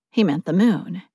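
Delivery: ordinary delivery, nothing marked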